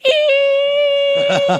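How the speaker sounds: piercing high screech, very loud; a loud squealing noise cutting across the radio; sudden shrieking feedback sound; imitated shrill screech. Shrill noise over the air.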